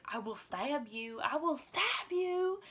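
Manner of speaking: mock menace that climbs on the repeat; mama bear threat. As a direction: imitate a fierce grandma, stretching each word. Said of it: "such a mama bear".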